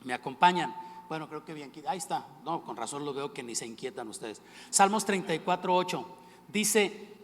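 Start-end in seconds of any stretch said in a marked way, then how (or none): none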